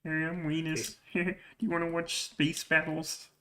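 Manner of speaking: soft, nerdy imitation; weenie nerd voice. Storyteller imitating the nerdy cartoon kid softly. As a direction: offer it like a timid nerd. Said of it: "he's like".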